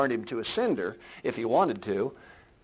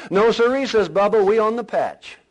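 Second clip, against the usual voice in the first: punchline; 9 semitones higher, 10 dB louder than usual. Acted. Bubba's punchline in joke.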